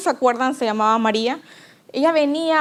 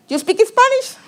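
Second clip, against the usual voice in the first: high, louder English question to a stranger; asking in English; 9 semitones higher, 4 dB louder than usual. Retold or imitated. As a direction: try English on the old lady. Friend asks grandma in English.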